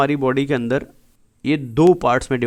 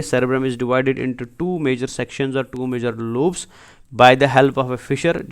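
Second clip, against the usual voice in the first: each word spelled out very slowly for notes; slow it right down so students can write; slow dictation; much slower than usual. Shown as a demonstration. Teacher dictating a definition slowly.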